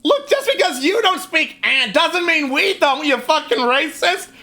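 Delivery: high, indignant goblin accusation; offended goblin. Acted. Goblin accusing the adventurer of racism. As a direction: sneer it like a goblin who's been insulted.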